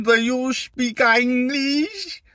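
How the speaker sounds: high, very slow mock tourist voice; silly put-on voice. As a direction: ask it like a clueless tourist abroad. Mock asking a local for English.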